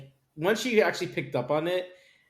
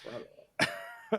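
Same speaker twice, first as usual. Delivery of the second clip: squeaky wow; 6 dB quieter than usual. Real, not acted. Reacting to the brother-sister marriage.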